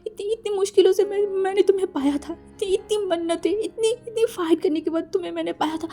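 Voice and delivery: begging someone not to leave, in a nightmare; panicked sleep talk. Acted. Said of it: "बार-बार बोल रहा था". (नींद में घबराकर गिड़गिड़ाना)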